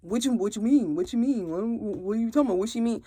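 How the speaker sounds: quiet, lightly voiced confused husband. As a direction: play dumb, softly, a hint of the husband. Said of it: "And he looking".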